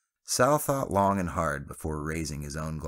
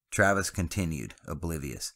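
plain reading voice

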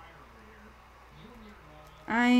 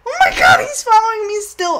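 real panicked scream, much higher and louder; terrified shout; 9 semitones higher, 7 dB louder than usual. Real, not acted. Streamer chased by the killer, screaming in real panic. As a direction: shriek it as he chases you.

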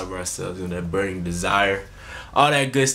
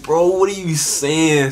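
loud, high, drawn-out baffled question; mock bafflement; 6 semitones higher, 5 dB louder, slower than usual. Nephew to uncle with thick accent, mock baffled. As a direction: act out being totally lost, slow and loud.

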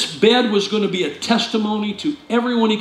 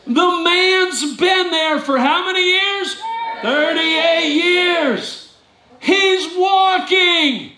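high, very slow, stretched out disbelief; incredulous preaching shout; 9 semitones higher, much slower than usual. Real, not acted. Pastor telling the congregation the healed man is walking, slow and incredulous. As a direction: hammer each word home.

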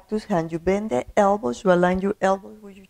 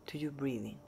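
guided meditation; 3 semitones lower, 17 dB quieter than usual. Acted. Teacher welcoming listeners to a breathing meditation, soft guided meditation voice. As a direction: ease them gently into calm, quiet and unhurried.

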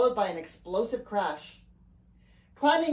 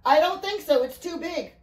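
raised, higher, urgent voice; urgent answer; 7 semitones higher, 5 dB louder than usual. A narrator's reading. Father answers Riley during the attack, urgent answer. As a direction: answer hurriedly over the danger.

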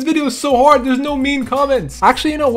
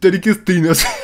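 mock imitation; 3 semitones lower than usual. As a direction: shout back your old line. Mocking his old voice loudly.

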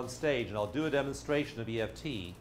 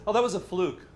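higher, louder dismissive scoff; scoffing demon; 6 semitones higher, 5 dB louder than usual. Retold or imitated. Demon dismissing the successful demo, scoffing loudly. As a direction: brush it off as a fluke. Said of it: "the demon says".

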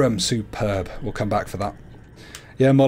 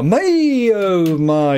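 high swooning "My"; mock swoon; 5 semitones higher, 3 dB louder, much slower than usual.

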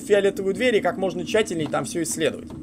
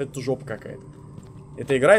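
normal talking voice

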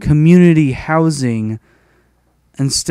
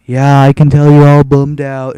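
mock sympathy; 6 dB louder than usual. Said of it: "She was like".

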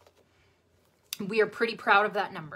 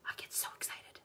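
breathy excited whisper; 17 dB quieter than usual. Real, not acted. Teacher confiding her excitement to viewers, whispered. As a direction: share it like a secret. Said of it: "That's how excited I get about it".

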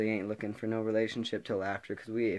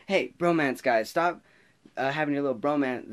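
higher, louder playful teacher telling off; mock teacher scolding; 5 semitones higher, 5 dB louder than usual. Teacher scolding two hugging boys, loud and playful. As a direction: call the boys out half-laughing. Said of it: "she's like".

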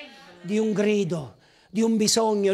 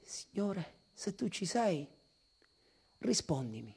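much softer, low, hesitant plea; doubtful prayer; 5 semitones lower, 13 dB quieter than usual. Retold or imitated. Preacher recalling unanswered prayers to God, hushed. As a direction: pray as if no one listens.

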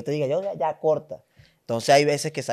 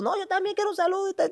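very high, fast mock aunt voice; pushy aunt; 4 dB quieter, faster than usual. Second aunt demanding a greeting, high mock voice. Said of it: "llegó la otra tía".

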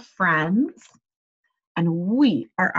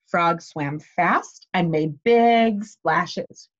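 slow emphatic reading; 3 semitones lower, much slower than usual. Shown as a demonstration. Reading aloud to children, slow.

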